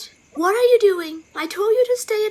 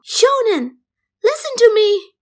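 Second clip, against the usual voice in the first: louder, tearful call of the name Shonen; crying out name; 3 semitones higher, 8 dB louder than usual. Acted. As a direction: call Shonen through tears. Mother calls after upset child.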